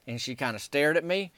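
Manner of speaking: ordinary talk, nothing marked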